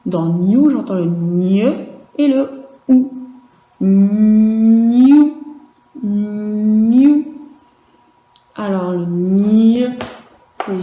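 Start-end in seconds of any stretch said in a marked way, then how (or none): 3.8-7.2 "New" called out loudly and clearly; loud dictation. Shown as a demonstration. Teacher calling out the syllable to pupils, loudly. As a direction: project "New" to the whole class. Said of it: "teacher dictating a syllable".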